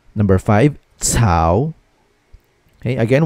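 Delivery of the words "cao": "cao" long, dipping low then rising; third tone. Shown as a demonstration. Teacher drilling learners in third tone, "cao" dipping and rising. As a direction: sink down on "cao" and climb back up. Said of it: "One more time".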